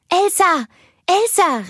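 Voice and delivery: put-on high, loud child's call; calling out as little Anna. A narrator's reading. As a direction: call your sister awake, high and eager. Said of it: "rief sie". (hohes, lautes Kinderrufen)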